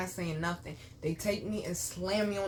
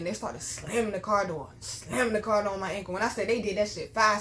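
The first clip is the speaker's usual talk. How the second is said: usual speaking voice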